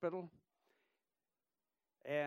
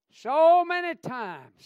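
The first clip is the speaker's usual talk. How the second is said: much louder, higher, slow cry; preaching outburst; 10 semitones higher, 15 dB louder, slower than usual. Acted. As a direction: hit it hard like a revival shout.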